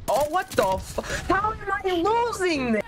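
high, outraged disbelief; frustrated disbelief. Real, not acted. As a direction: cry out at losing again. Losing a round in game.

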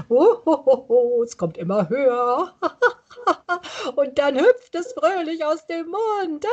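laughter rising from the feet. Shown as a demonstration. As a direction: let the giggle climb up. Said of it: "stellt euch doch bitte vor".

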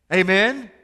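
loud rising call of amen. Shown as a demonstration. Preacher calling amen to the congregation, loud and rising. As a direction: call out amen, asking for a response. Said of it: "hear someone say amen".

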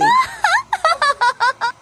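a high, light tinkling laugh; fairy's laugh. A narrator's reading. The Blue Fairy laughing at Pinocchio, high tinkling giggle. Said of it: "laughed the Blue Fairy".

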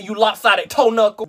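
ordinary talk, nothing marked

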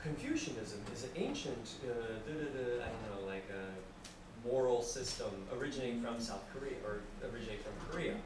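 low, quiet, hesitant reciting; mumbled dictionary reading. Acted. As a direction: mumble it like half-remembered definition.

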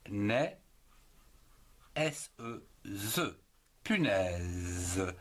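ordinary talk, nothing marked

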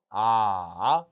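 아 dipping low then rising; third tone. Shown as a demonstration. Teacher demonstrating the third tone of 아, dipping. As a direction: drop 아 low, then let it climb back. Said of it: "삼성".

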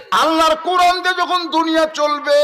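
high, loud chanted cry; full-voiced preaching shout; retold crowd shout. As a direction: cry it out as a whole crowd would, on a high sustained note. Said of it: "সমস্ত সাহাবীরা চিল্লায় বলে".

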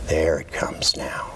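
slow hushed whisper. Real, not acted. Hypnotist guiding hand movement.

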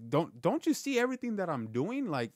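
high, desperate plea to God; pleading prayer. Retold or imitated. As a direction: beg God for help, voice climbing high. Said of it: "I'm crying out to, to God".

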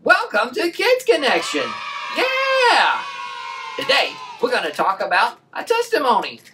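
put-on loud, high, cheering kids' presenter; excited kids' show host. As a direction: whip up the kids like a TV host. Kids show host opening.

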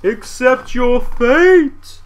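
high, very slow pronouncement; triumphant Santa. Acted. Santa sealing the brother's fate, triumphant drawl. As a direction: draw the doom out theatrically. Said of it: "he said triumphantly".

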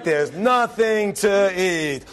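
slow, drawn-out complaint; drawn-out whine. Retold or imitated. Kid complaining to the parent, slow and drawn out. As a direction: stretch it like a bored kid. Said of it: "you get the idea".

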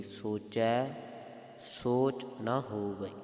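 ਸੋਚੈ, brief halt, then ਸੋਚ ਨਾ ਹੋਵਈ slowly; slight pause. Shown as a demonstration. Teacher shows congregation where to break, pause after ਸੋਚੈ. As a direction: lift ਸੋਚੈ and rest before ਸੋਚ. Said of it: "ਥੋੜ੍ਹੀ ਜਿਹੀ ਝਪਕੀ ਲਗਾਉਣੀ".

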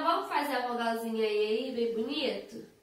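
usual speaking voice

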